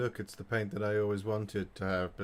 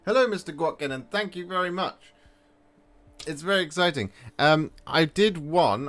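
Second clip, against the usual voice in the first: excited; 7 semitones higher, 7 dB louder than usual. Real, not acted. Thanking a viewer.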